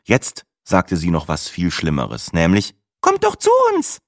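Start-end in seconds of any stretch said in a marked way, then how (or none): 3.0-4.0 very high-pitched, over-eager invitation; chirpy weather woman. Acted. Weather woman inviting the travellers over, chirpy. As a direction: squeak it out with gushing eagerness.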